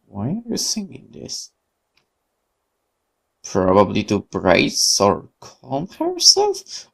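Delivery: ordinary talk, nothing marked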